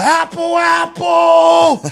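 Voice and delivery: high, hollered quarterback call; Peyton-style audible. Retold or imitated. Backup quarterback aping Peyton at practice, high shout. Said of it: "do the whole Peyton".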